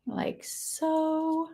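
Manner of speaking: a short whispered aside; whispered while demonstrating. Real, not acted. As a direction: breathe the words with no voice. Aside while layering the pieces.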